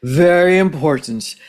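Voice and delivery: lightly put-on, very serious host voice; a hint of grand, solemn talk-show gravity; Oprah. Shown as a demonstration. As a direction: intone it with mock seriousness. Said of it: "very serious Oprah voice".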